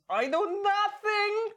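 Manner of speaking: put-on high, slow, loud German-accented "I know nothing"; Sergeant Schultz impression. Host imitating a sitcom guard's German accent, loud and slow. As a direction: wail it like a bumbling German guard. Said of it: "quote Sergeant Schultz from Hogan's Heroes".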